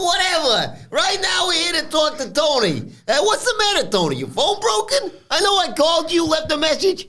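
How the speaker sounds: swagger like a Philly mob boss; mock Italian wiseguy scolding Tony; Nunzio impression. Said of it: "That's my Nunzio impression".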